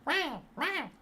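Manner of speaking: very high mock baby wailing; imitated baby cry. Imitating a crying baby in a story to chat, in a high mock wail. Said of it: "He keeps crying".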